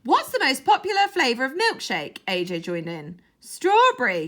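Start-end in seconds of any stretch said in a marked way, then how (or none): 0.0-2.2 high, loud call from upstairs; called from afar. A narrator's reading. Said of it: "a voice called from upstairs". 3.4-4.3 high, loud distant call; far-off answer. A narrator's reading. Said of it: "came the far-off answer".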